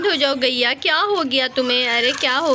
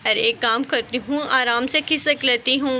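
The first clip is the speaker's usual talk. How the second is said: ordinary delivery, nothing marked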